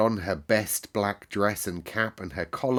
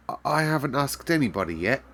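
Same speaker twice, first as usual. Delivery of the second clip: hesitant; 6 semitones higher than usual. A narrator's reading. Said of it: "said Mary hesitatingly".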